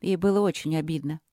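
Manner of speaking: plain reading voice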